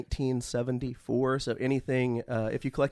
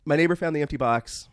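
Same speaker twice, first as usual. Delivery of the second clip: ordinary talk, nothing marked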